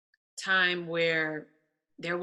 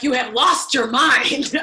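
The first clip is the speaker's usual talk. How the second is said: mock outrage; 5 semitones higher, 10 dB louder than usual. Voicing manager's disbelief.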